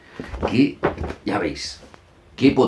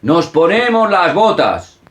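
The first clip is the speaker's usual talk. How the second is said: put-on higher, louder, slow call for the kids to copy; playful game call; 9 semitones higher, 6 dB louder, slower than usual. Shown as a demonstration. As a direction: lead the children's game with a big call. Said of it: "utilizarlo después de leerlo como juego".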